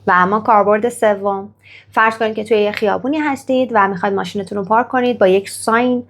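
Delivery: ordinary talk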